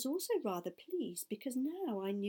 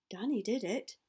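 ordinary delivery

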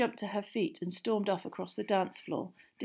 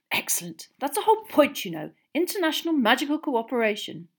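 raised, emphatic pomposity; pompous brother; 7 semitones higher, 7 dB louder than usual. A narrator's reading. Older brother praising international cooperation, pompous. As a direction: proclaim it self-importantly. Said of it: "looking extremely pompous".

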